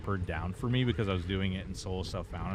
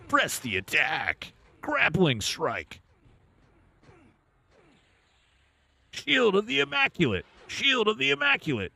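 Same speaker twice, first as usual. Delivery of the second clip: high, loud hammed-up ability callouts; weird voice; 13 semitones higher, 4 dB louder than usual. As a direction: shout each skill in a silly high voice. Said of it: "In maybe some weird voice".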